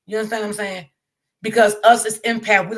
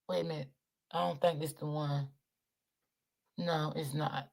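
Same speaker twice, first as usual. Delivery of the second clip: muttering to self; 6 semitones lower, 16 dB quieter than usual. Real, not acted. Muttering to herself while searching.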